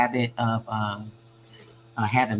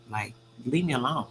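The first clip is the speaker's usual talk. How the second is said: ordinary talk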